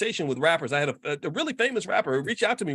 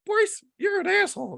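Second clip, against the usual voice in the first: high squeaky puppet heckling his owner. Retold or imitated. Ventriloquist's puppet insulting Boyce, in a high squeak. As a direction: voice a mouthy hand puppet, pitched way up. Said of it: "this is the Negro naysayer puppet".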